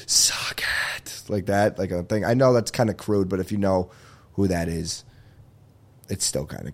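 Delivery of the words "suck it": breathy hushed catchphrase; whispered catchphrase. Shown as a demonstration. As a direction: whisper "Suck it". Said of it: "A button, just go".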